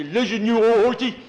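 mock tough shout at a horse; rough call to horse. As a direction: bark it like a bossy kid. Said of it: "I'd say".

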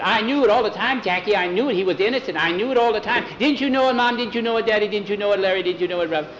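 put-on high, rushed, excited child voice; excited little boy. A narrator's reading. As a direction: gush it out, question tumbling after question. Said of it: "he was so excited".

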